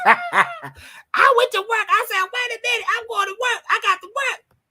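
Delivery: very high, mock-excited squeal; hammed-up high-pitched glee; comic re-enactment. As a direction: retell it in a silly, way-up voice. Comic re-enactment of going to work.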